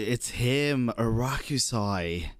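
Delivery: normal talking voice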